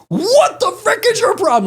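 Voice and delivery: high-pitched outraged yell; screaming at him. Retold or imitated. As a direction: scream it as a retold outburst. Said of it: "Like, screaming at him".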